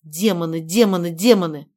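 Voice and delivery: from muttered curse to loud yell; building angry outburst. Acted. Cursing in frustration, building.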